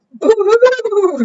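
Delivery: high, loud owl screech; screeching storybook owl. A narrator's reading. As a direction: hoot it out in fright, high and loud. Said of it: "screeched Owl".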